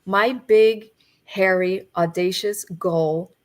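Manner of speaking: normal talking voice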